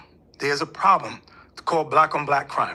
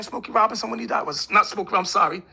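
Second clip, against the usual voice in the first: ordinary delivery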